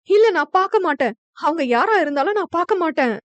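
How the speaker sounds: put-on panicked girl crying out that she won't look; sudden cry. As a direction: cry out with eyes shut, refusing to look. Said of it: "திடீரென சத்தமிட".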